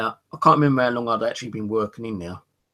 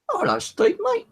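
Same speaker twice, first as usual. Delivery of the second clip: imitating the bully in a much higher voice; mock high greeting; 4 dB louder than usual. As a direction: voice jumps way up for the bully's cheery hello. Old school bully greets narrator.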